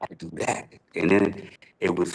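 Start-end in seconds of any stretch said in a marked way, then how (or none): 0.0-0.7 breathy whispered inner thought; whispered to himself. Retold or imitated. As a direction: whisper it like a vow to yourself. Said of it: "I said".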